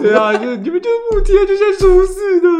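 high, loud whining, slowing down; whiny complaint. Retold or imitated. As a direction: complain tearfully. (委屈地哭诉)

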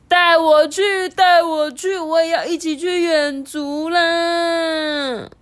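put-on high, slow childish pleading; whiny begging. Father whining to his son to take him along, high childish plea. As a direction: beg like a little kid.

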